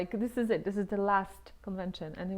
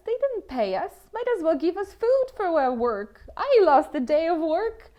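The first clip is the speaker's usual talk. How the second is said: loud, higher indignant rant; 9 semitones higher, 12 dB louder than usual. Retold or imitated. Telling others at lunch about the unpaid work, loud and indignant. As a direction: vent like you were never paid. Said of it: "It's like".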